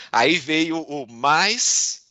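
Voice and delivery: slow, loud, "mais" drawn out rising; dramatic build-up. Retold or imitated. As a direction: stretch "mais" upward into a suspenseful cliffhanger. Builds up to the dreaded "but.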